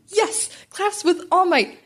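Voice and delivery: ordinary delivery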